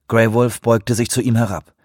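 ordinary delivery

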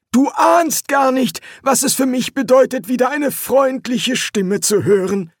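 high-pitched, relieved captive; grateful prisoner. Acted. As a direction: speak up gratefully to a rescuer. Prisoner greets his hoped-for rescuer.

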